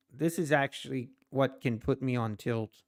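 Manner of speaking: ordinary talk, nothing marked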